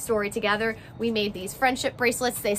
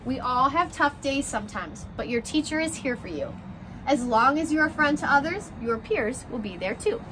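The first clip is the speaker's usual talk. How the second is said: gentle picture-book read-aloud, rhymes bouncing along; reading to children; 3 semitones higher than usual. A narrator's reading. Teacher reading a class picture book to her first graders, story-time voice.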